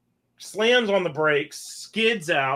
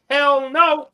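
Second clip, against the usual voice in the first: high, loud "hell no"; mock exclaim; 9 semitones higher, 7 dB louder than usual. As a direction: blurt it out, half laughing. Refusing invite to Josh's house.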